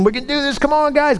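high-pitched rallying cry; rousing pep talk. Retold or imitated. Coach rallies the team at halftime, rousing pep talk. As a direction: fire up the team, voice lifted high. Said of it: "gets them all fired up".

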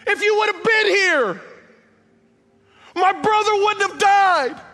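high and loud, full of blame; shouted accusation. Acted. Sister yells at Jesus over her dead brother, shouted accusation. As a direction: scream the blame at him.

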